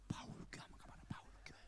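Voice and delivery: hushed whisper, like a secret; whispered tip. Retold or imitated. Friend secretly tipping off the pastor, whispered. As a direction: lean in and whisper the name.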